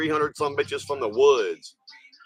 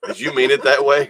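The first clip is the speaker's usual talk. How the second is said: ordinary talk, nothing marked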